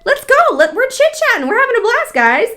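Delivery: high, loud, sing-song fake cheer; mock hun voice. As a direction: parody a pushy seller's bubbly pep talk. Mocking a fake supportive hun.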